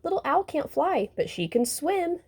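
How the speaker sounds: high, taunting sing-song; mocking hoot. A narrator's reading. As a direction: tease her from above, voice up. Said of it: "hooted the boys".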